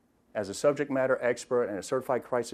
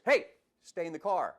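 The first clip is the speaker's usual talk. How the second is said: firm command; 6 semitones higher than usual. Retold or imitated. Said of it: "Chris using his tone of voice".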